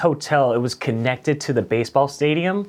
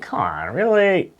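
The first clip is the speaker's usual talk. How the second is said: incredulous; 8 semitones higher than usual. Retold or imitated. Incredulous reply to manager.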